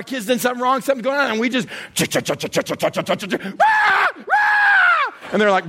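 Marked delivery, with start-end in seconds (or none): 1.9-5.1 chugging chant bursting into high horn blasts; freight train imitation. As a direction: chug like a train, then blare the horn. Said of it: "is like a freight train".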